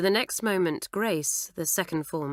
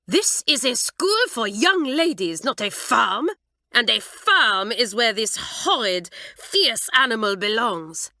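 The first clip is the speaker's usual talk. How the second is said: high, loud, slow French mistress scolding; indignant French teacher, every word spelled out; shrill French mistress; 5 semitones higher, 6 dB louder than usual. A narrator's reading. Mamselle scolding the new girl about the goat, shrill. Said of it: "her voice shrill".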